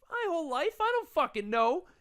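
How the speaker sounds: high-pitched retold exasperation; exasperated answer. Acted exasperated answer to stranger.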